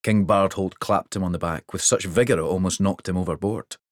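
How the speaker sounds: ordinary delivery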